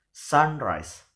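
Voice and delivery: "sunrise" with SUN punched, then falling; stress on SUN. Shown as a demonstration. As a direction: hit SUN, let "rise" drop. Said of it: "in the first syllable".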